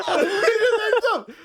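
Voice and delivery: high, loud words through laughter; laughing rant. Real, not acted. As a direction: rant while still cracking up. Laughing while ranting.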